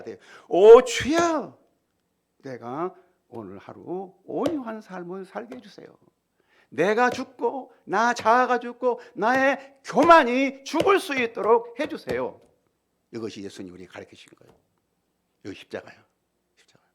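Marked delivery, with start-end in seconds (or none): 0.5-12.4 high, loud, slow crying out to God; fervent prayer. Acted. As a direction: cry out to God from the heart. Acted prayer to the Lord. (높고 크게 부르짖는 기도)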